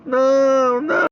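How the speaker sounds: cling to dad's leg and refuse to let go; clinging child refusing. Retold or imitated. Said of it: "ele gruda na perna do pai e fala".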